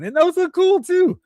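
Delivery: very high, loud exclamation; mock outrage. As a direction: pile on with squeaky disbelief. Mock outrage at toy prices.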